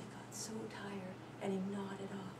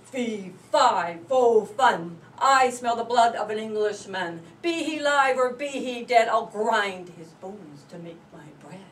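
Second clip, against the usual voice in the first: booming giant chant, much louder; giant's roar; 9 dB louder than usual. Acted. The giant storming home, booming threat. Giant announces himself, loud.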